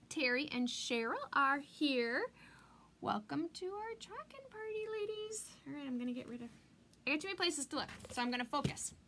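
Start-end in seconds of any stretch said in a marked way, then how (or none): 3.0-5.5 high, lilting party-host greeting; playful high-pitched hostess call; singsong welcome. Acted. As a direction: sing the welcome up high to the ladies. Welcoming arriving viewers.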